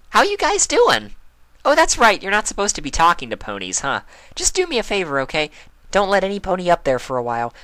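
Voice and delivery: very high, loud bubbly favor-asking; chatty Pinkie. A narrator's reading. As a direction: charm the guards into a favor. Pinkie sweet-talks the guards.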